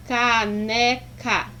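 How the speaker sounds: "caneca" slowly split into three syllables; each syllable of "caneca" said on its own. Shown as a demonstration. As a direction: pull "caneca" apart, ca, ne, ca. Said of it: "abri a boca três vezes para pronunciar".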